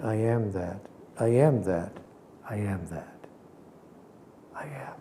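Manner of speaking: from low voice to whispered I am; fading mantra whisper. Shown as a demonstration. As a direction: let it fade into a whisper.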